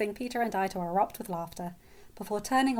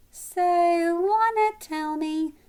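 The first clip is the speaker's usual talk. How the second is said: high, slow, drawn-out singsong question; teasing little sister; 10 semitones higher, 8 dB louder, slower than usual. A narrator's reading. Little sister pestering, gets cut off.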